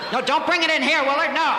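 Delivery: high, loud panicked yell; frantic, shouting him off as he walks in with the bomb. Acted. Police chief yelling at Willard not to bring the bomb in, panicked.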